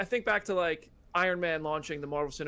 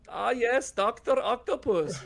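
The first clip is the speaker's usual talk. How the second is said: higher, drawn-out mock symbiote voice; badly faked, weirdly accented symbiote villain; 7 semitones higher, slower than usual. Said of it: "thought it was a symbiote voice".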